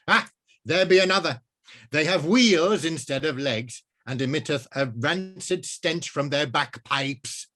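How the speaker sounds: high, loud, archaic wizard voice; alarmed old wizard. Acted. Wizard startled by cars, loud and high. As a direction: sound the alarm like a medieval mage.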